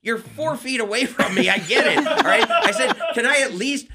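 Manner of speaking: comic snapping at the usher shining a flashlight. Retold or imitated. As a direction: lose it at the usher, half laughing. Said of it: "I finally snapped at him".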